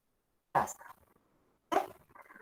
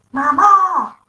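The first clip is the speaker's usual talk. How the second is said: urgent call; 7 semitones higher, 12 dB louder than usual. Shown as a demonstration. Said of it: "No es lo mismo decir".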